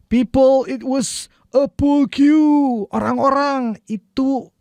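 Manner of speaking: high, slow call to the crowd; imitating film line. Shown as a demonstration. Teacher replaying a movie line slowly. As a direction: shout it out like the bar patron. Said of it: "repeating the film line".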